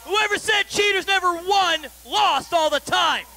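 ordinary talk, nothing marked

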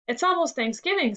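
mildly excited storybook quote. A narrator's reading. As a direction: lift the pitch with eager anticipation. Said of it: "said Ethan excitedly".

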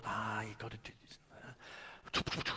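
hushed, slow acted quote; near-whispered imitation; mock grumble. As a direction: murmur it slowly under the breath. Acting out being told what to do.

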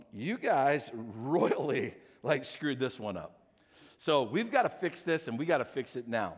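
low, restrained retold telling-off; stern complaint. Husband on the phone with United, firmly fed up. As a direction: lay down the law, voice held low.

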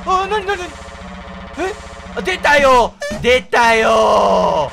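startled loud shout; hit hard crying out. Real, not acted. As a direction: yell out in shock. Startled by sudden game event.

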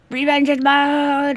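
hammy villain-hunter battle cry; mock battle cry. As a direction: declare vengeance like a hero. Vowing revenge in game.

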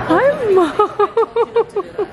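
high bright hello; light up greeting your mom; excited greeting. Real, not acted.

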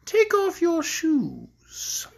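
high, drawn-out mock-polite order; cruel woman. A narrator's reading. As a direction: stretch out the request to torment him. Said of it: "continued the cruel woman".

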